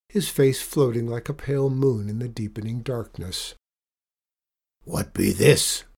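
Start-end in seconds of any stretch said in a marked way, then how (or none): 4.8-5.8 slow, loud pirate demand; sneering pirate. A narrator's reading. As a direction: ask with a suspicious pirate sneer.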